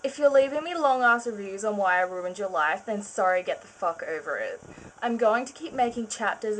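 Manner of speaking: usual speaking voice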